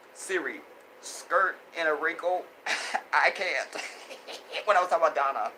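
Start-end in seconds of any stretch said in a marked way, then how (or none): none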